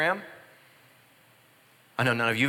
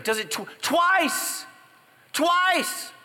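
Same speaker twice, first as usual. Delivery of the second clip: TWICE shouted high and slow; stress on twice; much slower than usual. Real, not acted. Preacher to congregation, shouting twice much higher. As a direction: hammer the word twice, higher each time.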